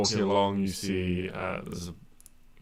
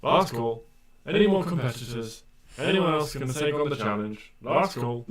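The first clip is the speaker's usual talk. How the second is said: raised, higher crier's call to a crowd; shouting out; 6 semitones higher, 6 dB louder than usual. Acted. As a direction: call out for last competitors. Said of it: "a voice shouting out".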